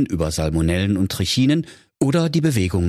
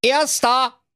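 high-pitched victorious hare cry; triumphant call. Acted. Hare arriving first at the snack bar, triumphant. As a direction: call out your win, sure of victory. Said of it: "siegesgewiss ... an und rief".

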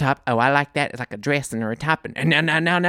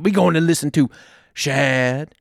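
normal talking voice

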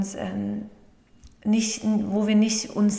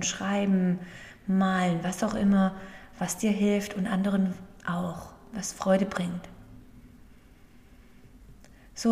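guided meditation. Acted. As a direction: gently guide the breathing, unhurried. (ruhige Meditationsstimme)

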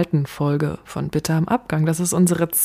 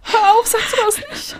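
high, pleading panicked voice; acted fear; 14 semitones higher, 4 dB louder than usual. Scared friend begging her not to say it, high panic. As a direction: beg her to stop in terror. Acted horror skit, killer clown.

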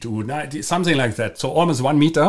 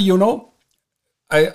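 usual speaking voice

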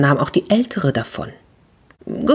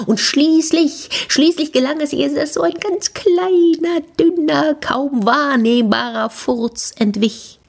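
high, drawn-out strained narration; tiny thin voice, as if pushing hard; straining; 11 semitones higher than usual. A narrator's reading. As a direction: squeeze the words out slowly, up high. Narrator enacts straining until release.

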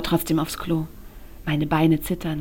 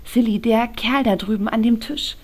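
a touch of fear, pitched higher; nervously asking about the man across the room; anxious question; 5 semitones higher than usual. A narrator's reading. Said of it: "frage ich ängstlich". (leicht ängstlich, etwas höher gefragt)

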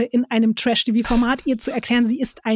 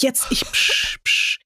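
imitating a shush; 2 semitones higher, slower than usual. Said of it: "Wie oft er dieses Psch".

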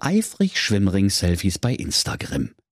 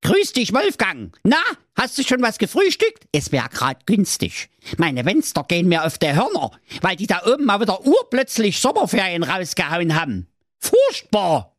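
much higher, fast gossipy goat voice; chatty mother goat on the phone; 10 semitones higher than usual. A narrator's reading. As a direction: gossip to the wolf, fed up with the kids. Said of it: "da sprach die Mutter Geiß zu ihm".

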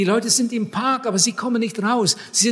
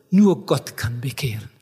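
objector; 7 semitones lower than usual. Retold or imitated. Acted objector in a dialogue.

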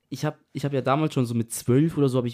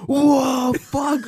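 laut und hoch herausgerufen; nachgemachter Schreckensschrei; 12 semitones higher, 7 dB louder than usual. Retold or imitated. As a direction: einen erschrockenen Schrei imitieren. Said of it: "Julian schreit so". (nachgeahmtes erschrockenes Schreien)

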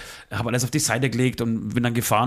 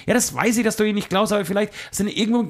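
higher, pleading friend's voice; friend insisting; 7 semitones higher than usual. Retold or imitated. Friend on the phone insisting the wallet is there, higher voice.

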